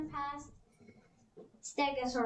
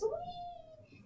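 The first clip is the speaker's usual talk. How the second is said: much higher, soft squeal of delight; high gleeful whee; 7 dB quieter than usual. Acted. Edna flying over the Triceratops, high soft whee.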